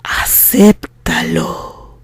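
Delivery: a deep, slow, chilling whisper; calm but terrifying. Acted. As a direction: draw it out low like a quiet threat. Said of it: "con la voz calmada, pero terrorífica".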